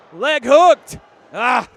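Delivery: shouted pin call; loud. Real, not acted. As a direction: yell the cover.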